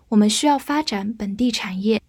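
ordinary talk, nothing marked

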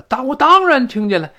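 "当然" punched high and loud; emphatic stress. Shown as a demonstration. Teacher acting the annoyed answer, strong stress on "当然". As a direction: hit "当然" hard, exasperated. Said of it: "这of course在这儿要重读了".

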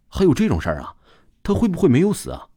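tentative, feeling him out; a cautious probing ask; probing question. A narrator's reading. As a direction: ask carefully, testing the waters. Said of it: "试探性地问了一句".

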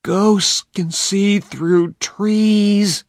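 put-on high, very slow unhappy boy; gloomy and drawn out; unhappily. A narrator's reading. Huck gloomily warning Tom about ghosts, slow and unhappy. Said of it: "said Huck unhappily".

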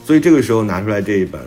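ordinary delivery

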